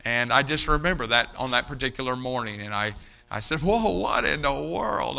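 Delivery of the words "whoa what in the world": drawn-out, higher amazed whoa; slow mock astonishment; surprised whoa. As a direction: act amazed at the sight. Surprised at friend's dress shirt.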